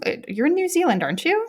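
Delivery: ordinary talk, nothing marked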